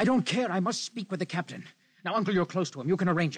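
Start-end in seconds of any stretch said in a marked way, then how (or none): 0.0-1.7 defiant outburst. Acted. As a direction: brush the danger aside hotly. Defiant reply to uncle's warning.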